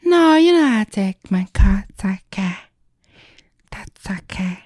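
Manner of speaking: high, unvoiced little whisper; tiny whisper. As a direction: whisper it shyly, barely there. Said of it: "that's what she sounded like".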